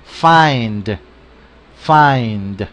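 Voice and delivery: find, d clearly ending; find, tap the final d. Shown as a demonstration. Teacher making the final d audible. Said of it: "Escuchemos la terminación con una D".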